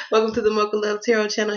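ordinary talk

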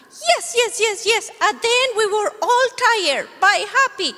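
high, bright little dog voice; puppy puppet. Acted. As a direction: play the eager puppy.

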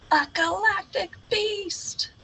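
high, shocked cry of beast; scared realization; frightened. Acted. Gasp before beast.

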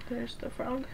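hushed pointing out; quiet aside. Real, not acted. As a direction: murmur it aside.

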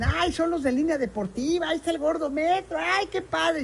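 a hint of a thrilled fan spotting a celebrity; excited fans. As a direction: mimic star-struck Puebla fans. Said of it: "y dicen".

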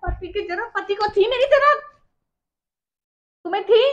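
loud, high, accusing question; indignant retort. Real, not acted. Wife retorts indignantly in quarrel.

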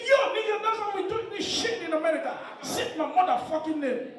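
high-pitched mock American girl; hammed-up high American voice. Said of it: "I know American girls, their ringtone is different".

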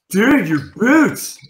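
high, drawn-out, loud disbelief; alarmed exclamation. Retold or imitated. Guy beside her looks down at boots, loud slow alarm. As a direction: stretch it out in mock shock.

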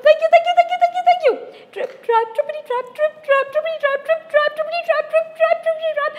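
very high, loud, happy goat voice; little goat thanking the troll, then trotting off; joyful little goat. Acted. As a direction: squeal your thanks and skip away.

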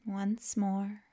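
calm, unhurried yoga nidra guidance with long pauses; guided meditation. Acted. Teacher guiding listeners to let the body melt, calm guided meditation. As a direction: ease them into rest, leaving space after each phrase.

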